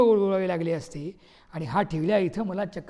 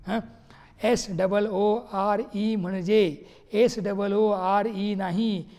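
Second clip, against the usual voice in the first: usual speaking voice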